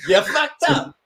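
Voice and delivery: same line jumped way up high, mocking; mock squeaky scolding. Telling someone off in a silly high squeak. Repeat, much higher mock.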